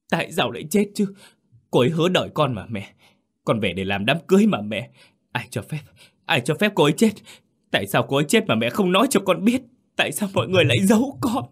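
high, frantic, grief-stricken outcry; điên dại. A narrator's reading. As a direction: rush the questions, pitch climbing hysterically. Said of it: "đầy vẻ điên dại". (gào hỏi điên dại, giọng cao vút)

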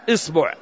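إصبع said "is-bu'", i then u; short i, then short u in إصبع; kasra, then damma. Shown as a demonstration. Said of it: "نكسر الهمزة فنقول".

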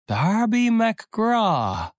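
very high, slow, long whining cry; keen the name like a haunting spirit; ghostly wail, rising. Acted. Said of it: "winselte es".

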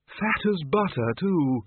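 high, slow, savouring character voice; backing up a boast. A narrator's reading. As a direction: add it with relish. Windle backs up the lie.

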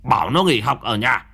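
angry father. Acted. Drunk father scolding wife.

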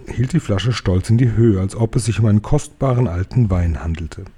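ordinary delivery, nothing marked

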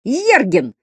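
high-pitched classroom yell, mild in volume; pupils' high shout; called-out shout. A narrator's reading. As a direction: call a name out to the teacher. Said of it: "закричали Слушкину".

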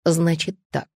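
understated icy menace; cold fury. A narrator's reading. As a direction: begin a reprimand with restrained rage. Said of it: "произнёс он в холодном бешенстве".

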